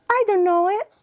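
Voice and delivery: put-on high-pitched kid who doesn't know; small, high child voice; little child. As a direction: answer like an unsure little kid. Child answering therapist in role-play.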